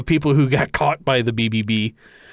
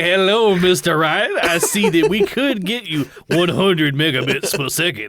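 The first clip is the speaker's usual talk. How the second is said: a hint of a genteel Savannah salesman on the porch; Southern gentleman salesman; 2 semitones higher than usual. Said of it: "How come nobody talks like that anymore?".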